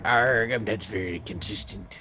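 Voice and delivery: lightly voiced pirate; a hint of pirate arr. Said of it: "I thought you were a pirate".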